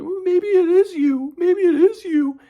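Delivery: slow singsong mock falsetto; stretched-out, very high girlfriend mimicry; imitating girlfriend. Girlfriend turning blame onto him, in slow mocking falsetto. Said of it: "But she was like".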